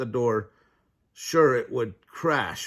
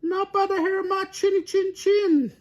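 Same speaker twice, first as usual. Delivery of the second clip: very high piggy squeal; 5 dB louder than usual. A narrator's reading. Elmer refusing the wolf in a high piggy wail. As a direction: shriek it like a tiny frightened pig. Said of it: "wailed Elmer".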